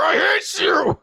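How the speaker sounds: high wailing mock scream; hammed-up tearful rage; angry screaming. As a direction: scream it hatefully at a computer. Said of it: "Just screaming angrily at your computer".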